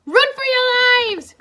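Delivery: high, loud, slow scream of panic; panicked cry. A narrator's reading. Shutoy screams at the group to flee, panicked high cry. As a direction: shriek at everyone to flee. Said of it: "Shutoy cried".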